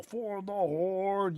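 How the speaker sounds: slow, drawn-out heroic rallying cry; Thrall war cry. Retold or imitated. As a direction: call it out slowly like the Horde warchief. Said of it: "who's got that thrall".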